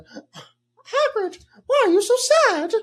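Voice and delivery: Harry calling out to a sad Hagrid; high kid voice asking tenderly; a boy's voice. Pretend. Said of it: "And Harry's like".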